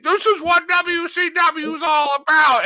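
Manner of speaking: high, slow announcer proclamation; announcer call. Retold or imitated. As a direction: call it like a big wrestling announcer. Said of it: "his iconic".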